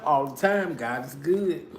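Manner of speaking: ordinary talk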